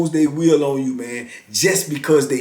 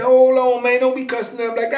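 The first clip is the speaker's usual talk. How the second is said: higher scolding sergeant, lightly voiced; retold sergeant; 10 semitones higher than usual. Sergeant scolding inmate for cussing, a high mock warning.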